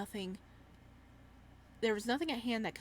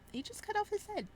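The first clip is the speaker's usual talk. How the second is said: high disbelief; 12 semitones higher than usual. Real, not acted. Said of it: "repeated high".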